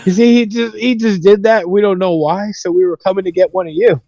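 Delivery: high-pitched mock-innocent tattling; feigned innocence. Boys blame kid to counselor.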